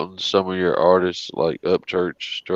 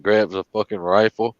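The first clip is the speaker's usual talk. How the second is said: usual speaking voice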